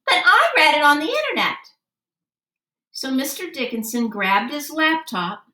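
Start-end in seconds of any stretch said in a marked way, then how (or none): 0.1-1.6 fast, high and loud comeback; defiant retort. Acted. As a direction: fire it back quickly and defiantly. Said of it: "threw me a withering look".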